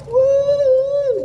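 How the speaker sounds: high, loud woo; excited whoop. Real, not acted. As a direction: let out a whoop. Whooping in the tunnel.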